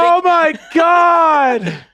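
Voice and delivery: high, loud, drawn-out victory yell; ecstatic shout. Real, not acted. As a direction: scream it to the whole stream, slowly. Celebrating a tied game.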